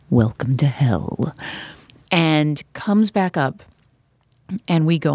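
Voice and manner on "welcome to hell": low, slow voice from hell; ominous announcer voice. Retold or imitated. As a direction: drag it out low as a stage voice-over welcoming the damned. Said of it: "this voice that comes over and says".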